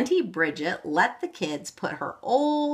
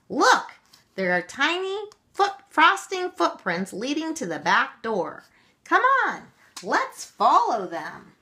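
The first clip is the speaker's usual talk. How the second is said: excited; 9 semitones higher, 4 dB louder than usual. A narrator's reading. Excited boy urging.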